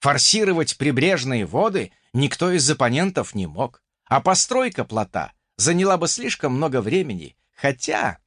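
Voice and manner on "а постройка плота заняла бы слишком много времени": usual speaking voice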